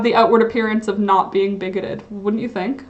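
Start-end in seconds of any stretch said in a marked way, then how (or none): none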